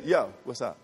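low, soft throwaway greeting; casual greeting. Shown as a demonstration. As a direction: toss it off like a passing hello. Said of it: "Yo is like, "Yo, I'll see you later".

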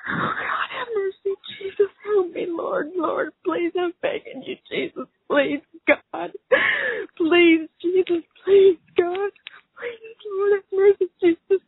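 desperate crying prayer, pitch up; begging God through tears; sobbing prayer. Real, not acted. Mother praying for her missing son, sobbing.

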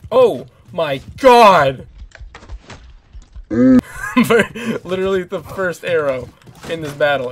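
loud, drawn-out amazed outburst with a laugh; amazed shout. Real, not acted. Gamer stunned by a lucky arrow, shouting and laughing. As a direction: shout in disbelief at a lucky shot.

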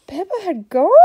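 high, loud gasp and question; shocked gasp. Real, not acted. Parent reacts to story.

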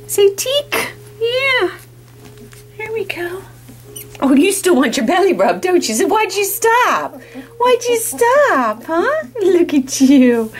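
high, drawn-out cooing. Real, not acted. Owner coaxing a puppy to keep rolling, high baby talk.

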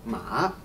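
"ma" from middle down low, then up; dipping third tone. Shown as a demonstration. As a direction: make "ma" dip and climb back. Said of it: "goes lower and then higher".

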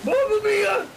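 high laughing shriek; panicked scream. Acted. Being eaten by shark.

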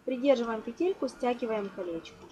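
normal talking voice

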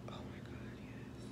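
soft unvoiced whisper of joy. Real, not acted. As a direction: breathe it out thrilled.

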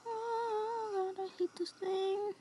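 high, whimpering, near crying; trembling whisper. Real, not acted. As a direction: whimper it, close to tears. Terrified, near tears.

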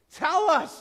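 high, loud, forceful demand; full-voiced insistent yell; demanding shout. A narrator's reading. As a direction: shout it as an order. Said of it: "demanded a third".

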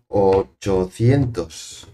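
"Ochocientos" very slowly drawn out; slow, weighty stress on "Ochocientos"; emphatic stretched number. Real, not acted. Corrects the guess, emphasising the number.

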